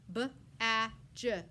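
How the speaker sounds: "B-a-dj" said as three separate sounds; sounded out. Shown as a demonstration. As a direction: segment "B-a-dj" slowly for the class.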